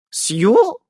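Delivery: high, loud aghast echo; horrified. A narrator's reading. Hero horrified at the name, high and loud. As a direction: gasp it in horror. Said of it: "ужаснулась".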